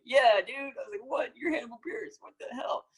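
high-pitched giddy disbelief; starstruck excitement. Retold or imitated. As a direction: retell a fanboy freak-out, voice shooting way up. Starstruck reply to his idol, retold.

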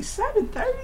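high, soft disbelieving "Seven thirty?"; incredulous echo. Real, not acted. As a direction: repeat it in disbelief.

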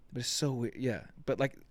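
ordinary delivery

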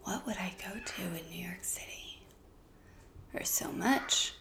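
soft unvoiced musing whisper; whispering to herself. Real, not acted. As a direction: whisper the thought to yourself. Thinking aloud about New York.

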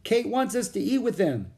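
put-on high-pitched child calling; eager high call to a friend. A narrator's reading. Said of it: "Annie called outside the hut".

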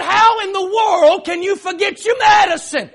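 loud, high, incredulous outburst; exasperated scolding. Retold or imitated. Husband scolding wife over forgotten medicine, acted exasperated shout. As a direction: act out shouting in disbelief.

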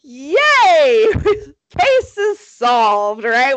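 hammed-up triumphant cops; mock celebration. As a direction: celebrate too soon, drawing it out. Acted cops celebrating a solved case.

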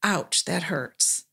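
soft, slow and low; name the hurt softly and slowly; a calm, quiet acknowledgment of pain; gentle ouch. Shown as a demonstration. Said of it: "then we can say".

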